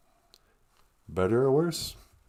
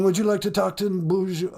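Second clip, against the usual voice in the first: ordinary talk, nothing marked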